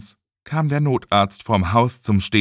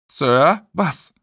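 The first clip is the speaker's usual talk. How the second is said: higher-pitched questioning call; puzzled call; 5 semitones higher than usual. A narrator's reading. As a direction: call after someone, puzzled. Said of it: "hörte er noch Mel hinter sich rufen". (verwundertes Rufen)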